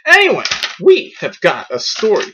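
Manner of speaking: high, loud, punched announcement; hammed-up presenter. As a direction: proclaim each word like a show host. Resumes hammed presenter act.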